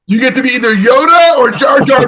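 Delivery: high, loud game-show style reveal; excited announcement. Real, not acted. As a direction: shout out the result with glee. Announces the wheel's result.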